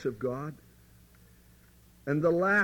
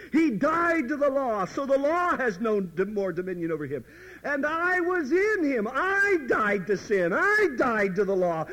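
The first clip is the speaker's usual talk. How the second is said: the believer suddenly seeing it, joyful realization; eureka realization; 7 semitones higher than usual. Retold or imitated. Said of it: "and you say".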